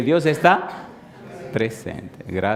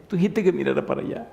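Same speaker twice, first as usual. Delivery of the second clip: niño; 6 semitones higher, faster than usual. Acted.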